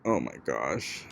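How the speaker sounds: barely audible, low and slow; quiet. Real, not acted. Exhausted aside, very quiet.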